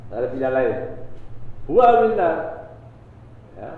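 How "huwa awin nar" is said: stern verdict. Retold or imitated. Preacher stresses the Prophet's verdict.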